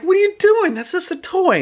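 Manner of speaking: put-on very high, incredulous boy voice; squeaked-up protest; alarmed boy. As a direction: imitate a kid panicking at a friend. Skipper alarmed at Libby's bluff.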